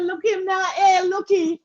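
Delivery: high teasing call breaking into laughter; amused mock exclamation. Host retells watching someone on the ground, laughing mock call. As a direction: mimic a gleeful onlooker, laughing.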